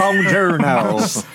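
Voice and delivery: slow, drawn-out hammed Italian accent; mock Italian accent. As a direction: stretch it like a cartoon Italian. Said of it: "Oh, it's Chris Pratt Italian".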